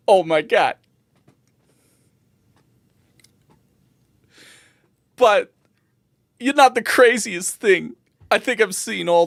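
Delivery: high, loud barkeep in short bursts; earnest bartender. Acted. Bartender assuring a Lalafell customer, high, loud and earnest. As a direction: reassure in a high, emphatic voice.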